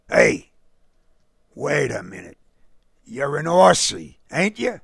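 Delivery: lightly voiced streetwise local, loud at first; Brooklyn fan. A narrator's reading. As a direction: challenge the stranger like a ballpark regular. Fan voiced, guessing stranger's origin.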